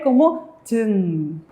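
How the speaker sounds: tun drawn out slowly, final nasal ng; model reading. Shown as a demonstration. Teacher models the syllable for students, slow and clear. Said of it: "¿cómo se va a leer?".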